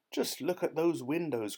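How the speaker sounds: mildly thrilled cry; excited cry. A narrator's reading. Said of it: "cried the monkey".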